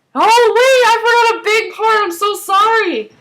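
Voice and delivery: high, loud burst of apology; sudden alarmed realization. Real, not acted. Vlogger realizing she forgot a big part, real flustered exclamation.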